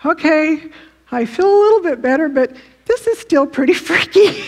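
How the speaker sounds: mock freaked-out relief, breaking into a laugh; acted nervous thought. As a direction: voice an uneasy inner reaction, playfully. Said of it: "You think".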